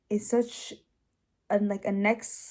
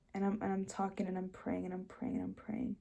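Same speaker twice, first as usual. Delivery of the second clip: much softer, trailing into a murmur; hushed recounting; 13 dB quieter than usual. Real, not acted. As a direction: let the repeats sink into a hush. Recounting long tearful prayer, hushed.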